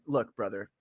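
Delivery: ordinary talk, nothing marked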